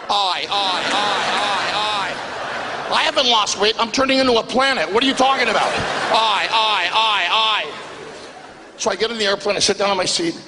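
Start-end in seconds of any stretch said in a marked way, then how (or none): 0.1-2.9 drawn-out aye aye of dismay; Greek groan. Retold or imitated. Groaning at the remark. 2.9-5.7 higher, rising outrage; protest, building indignant; comic outrage at being called thin, pitched higher. Retold or imitated. Indignant rant. 6.1-7.7 drawn-out aye aye of dismay; Greek groan. Retold or imitated. Groaning again.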